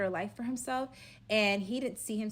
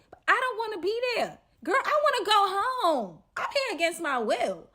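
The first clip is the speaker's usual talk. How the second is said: fed-up whine; 11 semitones higher, 8 dB louder than usual. Retold or imitated. Her inner complaint about being trapped.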